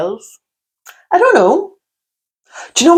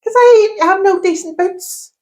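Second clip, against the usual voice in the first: higher mock voice of a friend; imitating Donna; 11 semitones higher than usual. Friend explains her bare toes, mock high voice. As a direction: put on a squeaky friend voice. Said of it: "that doesn't sound like".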